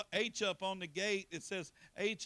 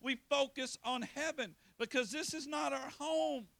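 high, loud preaching climax; preacher at the peak of the sermon; impassioned preaching; 9 semitones higher, 4 dB louder, slower than usual. Acted. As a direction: cry it out to the congregation. Preaching peak, much higher.

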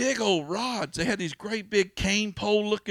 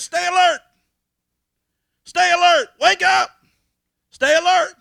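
booming shout; 12 semitones higher, 12 dB louder than usual. Acted. Preacher shouting God's warning to the church. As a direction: shout the command at full force, high and loud.

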